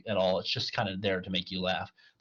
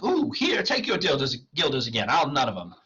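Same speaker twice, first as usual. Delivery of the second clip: freaked out; 6 semitones higher, 5 dB louder than usual. Acted. Clown hands the guilders back, mock panicked. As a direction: yelp and hastily refuse. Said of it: "this freaks the clown out".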